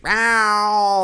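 high loud cat meow. Retold or imitated. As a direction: yowl like a hungry cat. Cat waking owner.

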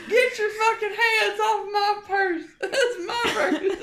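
very high crying shriek; meme scream. Retold or imitated. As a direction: wail it, laughing through. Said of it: "meme impression".

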